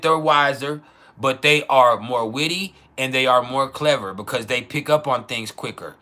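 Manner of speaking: usual speaking voice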